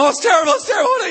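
high, loud shriek of disgust; screaming. Retold or imitated. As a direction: scream it out, repulsed. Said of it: "we're both screaming".

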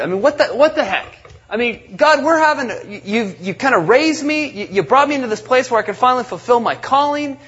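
imagined David's protest. Retold or imitated. Imagining David questioning God.

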